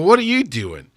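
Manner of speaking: normal talking voice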